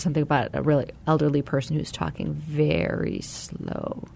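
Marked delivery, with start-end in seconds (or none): 2.3-4.1 very slow. Retold or imitated. Guest describing an elderly person on a call, very slow.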